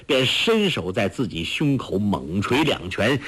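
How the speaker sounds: plain reading voice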